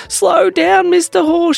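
high, loud plea; frightened shout. Acted. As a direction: call out while clinging on. Elsie holding on tight.

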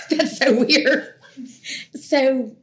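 slow, amused laugh through the words. Real, not acted. Telling the interviewer about the letter, laughing.

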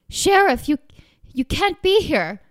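high, loud, startled protest; frightened cry to keep him away; alarmed. Acted. Wife panics at sheriff's arrival, high alarmed cry.

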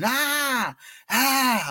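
high yelped ah, ah; imitated scream. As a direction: mimic the buddy's high pained cry. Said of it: "I hear my little buddy screaming".

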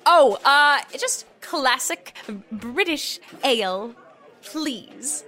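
a fake Brit trying hard to sound British; put-on British accent. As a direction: tourist in a British pub overdoing the accent. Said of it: "walking into a British pub".

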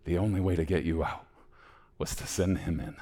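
soft, understated sincerity; solemn tenderness. Acted. Old man tells the boy his worth, soft and solemn. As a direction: quietly confide the cost.

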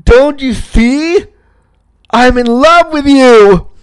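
high, loud, drawn-out mock love declaration; melodramatic diva. Camper loudly confessing love to the counselor, melodramatic diva. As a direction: protest your love like a hammed-up drama queen. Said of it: "being such a diva about it".

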